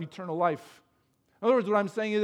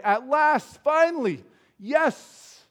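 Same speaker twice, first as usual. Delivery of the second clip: higher, louder, rising with delight; joyful exclamation; 7 semitones higher, 5 dB louder, much slower than usual. Shown as a demonstration. Preacher acting out Adam's delighted cry over the woman. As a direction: cheer it out like good news. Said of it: "Adam's response is one of joy".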